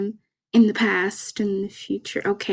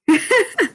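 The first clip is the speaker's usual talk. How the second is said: high, bright burst of laughter; a sudden embarrassed giggle; 11 semitones higher, 4 dB louder than usual. Real, not acted. Podcaster giggling at her own cheeky Damon remark, high laugh.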